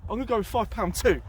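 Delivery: ordinary delivery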